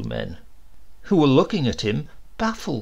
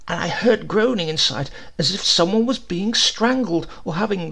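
put-on a higher, anxious old postman; a hint of the fretful old postman; lightly voiced postman; 6 semitones higher than usual. A narrator's reading. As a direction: rush out the story a bit high. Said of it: "The postman replied".

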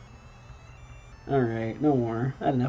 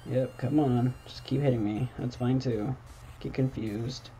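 normal talking voice

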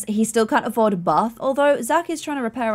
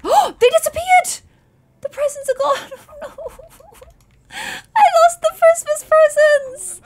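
much higher squeaky shriek of mock horror; hammed-up panic; 5 dB louder than usual. To the viewers as the presents vanish, a much higher mock panicked shriek. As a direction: shriek like you've ruined Christmas.

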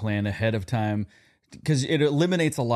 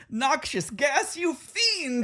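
much higher, slow melodramatic villain cry; cartoon villain; much slower than usual. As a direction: shriek it like a foiled cartoon baddie. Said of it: "You're like a villain from, like, Bullwinkle".

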